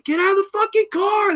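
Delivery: high, strained yelled command; screaming order. Retold or imitated. Ben screaming at his bitten friend to leave, high yell. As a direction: scream it at your best friend. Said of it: "They're screaming at each other".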